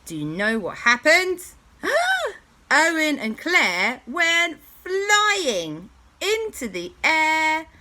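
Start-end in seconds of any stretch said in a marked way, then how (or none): 1.8-2.4 sharp high dramatic gasp; big gasp. Acted. Dramatic moment before flying. 2.7-7.7 high, loud, slow storytelling swoop; dramatic build. A narrator's reading. Narrator dramatizing the fling.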